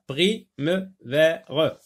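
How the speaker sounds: "Prime-vers" very slowly split as pri, me, ve, re; syllable by syllable. Shown as a demonstration. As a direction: sound out "Prime-vers" with a gap after each syllable. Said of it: "On va sectionner".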